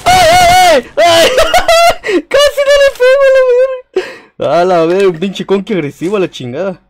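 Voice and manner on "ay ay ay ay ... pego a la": shrill high screaming and laughing; panicked screams. Real, not acted. Player screams as an enemy jumps him. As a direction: screech in real panic.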